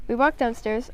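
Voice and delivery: plain reading voice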